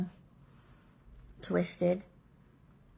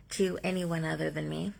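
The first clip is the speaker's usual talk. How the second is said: ordinary talk, nothing marked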